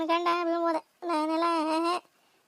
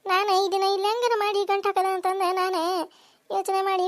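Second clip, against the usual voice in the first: usual speaking voice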